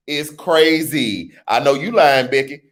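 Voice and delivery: ordinary talk, nothing marked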